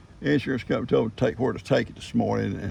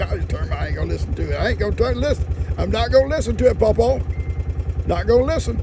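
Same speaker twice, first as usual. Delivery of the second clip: stubborn kid imitation; 8 semitones higher, 4 dB louder, faster than usual. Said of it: "He said".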